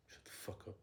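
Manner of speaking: ordinary delivery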